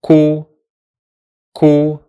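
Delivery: "ko" said as one short syllable; syllable drill; syllable demo. Shown as a demonstration. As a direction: model "ko" slowly for learners. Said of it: "sounds like this".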